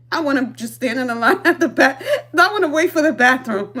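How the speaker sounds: real laughter running under it; amused, laughing as she talks. Real, not acted. Laughing at her own story.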